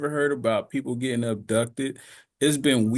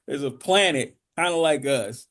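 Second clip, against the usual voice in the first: ordinary delivery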